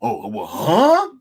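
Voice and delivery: slow mock huh breaking into laughter; hammed-up huh, then a loud laugh; mock surprise into laughter. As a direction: fake confusion, then crack up. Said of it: "couple days later".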